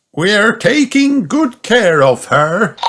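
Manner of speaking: lightly voiced grandpa; Grandpa Pig's voice. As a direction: reassure like a gentle grandpa.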